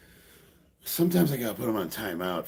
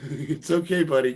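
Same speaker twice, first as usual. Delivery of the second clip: ordinary talk, nothing marked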